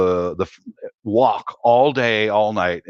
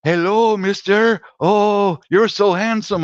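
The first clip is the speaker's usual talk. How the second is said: higher, coaxing come-on; flirty ladyboy voice; 8 semitones higher, 3 dB louder than usual. Retold or imitated. Ladyboy flirting with a tourist, higher, coaxing come-on. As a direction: sweet-talk a stranger outside the shop. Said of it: "And she'd go".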